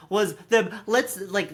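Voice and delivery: ordinary talk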